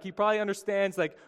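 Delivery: ordinary talk, nothing marked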